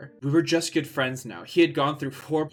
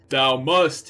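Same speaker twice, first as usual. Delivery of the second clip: much louder order; yelled; 7 dB louder than usual. Acted. Voldemort yelling at Ebony, a loud command. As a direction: bark it down at her. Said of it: "he yelled".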